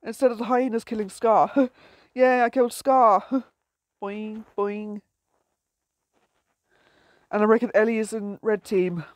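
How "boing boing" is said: soft, springy imitation of bouncing; sing-out a quiet little boing, boing; boing noise. Imitates bouncing sound in game.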